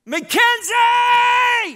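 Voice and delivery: very high, loud, long drawn-out call; desperate shout. Retold or imitated. Mother calling her lost child, drawn out. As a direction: wail the name across the street. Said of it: "doesn't care if they think she's a weirdo".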